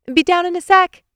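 high, loud yell from upstairs; yelling back. A narrator's reading. Nicole upstairs answers Carl, yelling back. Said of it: "she yelled back".

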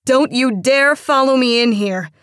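loud, high angry warning; yelled warning. A narrator's reading. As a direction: yell it back as a threat. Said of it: "she yelled a warning".

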